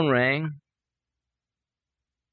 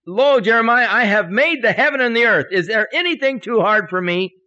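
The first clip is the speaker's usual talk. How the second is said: loud, high, ringing declaration; God proclaiming; 8 semitones higher, 7 dB louder than usual. Retold or imitated. God challenging Jeremiah as Creator, proclaiming. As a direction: proclaim it with divine authority. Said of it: "God said to Jeremiah".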